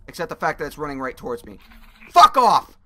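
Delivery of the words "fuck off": loud, high fuck off; panicked yell. Real, not acted. Monster runs at him.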